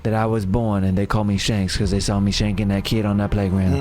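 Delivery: deep, menacing street tough; low gangster voice telling his origin; gangster character voice. Acted. Shanks origin story bit.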